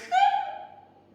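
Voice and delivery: short, high, loud yelp of shock; startled shriek. Retold or imitated. Woman opening her eyes to a shock, startled shriek. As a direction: let out a sudden high squeal. Startled on seeing him undressed.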